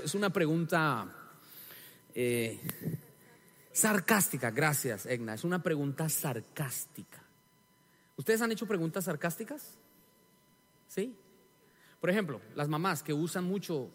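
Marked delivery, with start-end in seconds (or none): none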